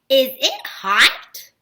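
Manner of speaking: "Is it hot?" slow, rising at the end; question voice. Shown as a demonstration. Teacher repeating "Is it hot?" to show the question mark, questioning voice. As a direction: ask "Is it hot?" stretched out, lifting "hot". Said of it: "A question mark".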